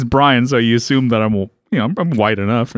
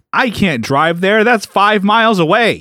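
whiny client impression; 4 semitones higher than usual. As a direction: complain in a put-on character voice. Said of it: "How come you talk like that?".

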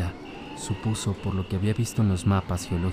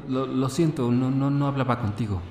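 nervous stammer; 5 semitones higher than usual. Acted.

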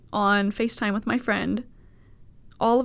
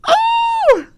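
high, loud "oh" of horror; sudden shrieked groan of embarrassment; mortified wail; 10 dB louder than usual. Real, not acted. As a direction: cry out, pitch shooting up. Mortified cry at the bong story.

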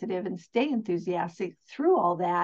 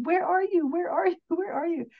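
worried calling; 8 semitones higher than usual. Retold or imitated. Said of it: "I kept calling and going".

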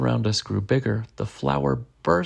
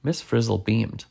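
ordinary delivery, nothing marked

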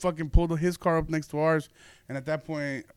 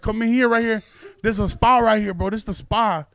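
insistent urging; 5 semitones higher, 6 dB louder than usual. Retold or imitated. Drunk man urging them inside.

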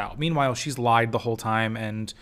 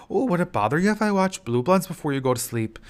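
put-on quick, higher, louder Minnesota accent, cheery; Minnesota nice accent; 6 semitones higher, 5 dB louder, faster than usual. Nice Midwestern husband asking about Blue Bloods, in a higher, chirpy Minnesota accent. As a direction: chirp it in a sing-song Minnesota lilt. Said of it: "He is Minnesota nice".

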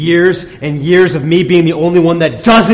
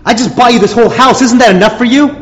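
higher and louder, furious at being asked; angry outburst; 6 semitones higher, 4 dB louder, faster than usual. Acted. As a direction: let the anger burst out. Husband's angry outburst in argument.

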